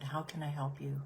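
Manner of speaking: soft, tender, almost whispered; How can I help you, darling hurt, whispered with mercy; compassionate whisper. Shown as a demonstration. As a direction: breathe it gently to the hurt like a parent. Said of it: "do you hear the tone in my voice? It's compassion. It is mercy".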